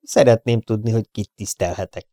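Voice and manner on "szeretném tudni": usual speaking voice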